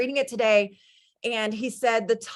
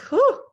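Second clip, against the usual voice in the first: sharp high whew of emotion; real emotional exhale, much higher and louder; 8 dB louder than usual. Real, not acted. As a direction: let out a high overwhelmed whew. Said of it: "I'm all emotional".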